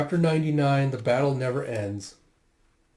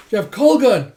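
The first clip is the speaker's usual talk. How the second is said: high, loud alarmed call; 8 semitones higher, 11 dB louder than usual. Retold or imitated. Calling to Colgan as the soldier closes in, alarmed shout. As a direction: cry out in alarm.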